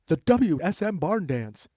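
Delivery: put-on big, stretched-out old radio show intro; radio announcer. Host recreating a live barn dance broadcast, announcer voice. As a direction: announce it with high, showy lifts on the names.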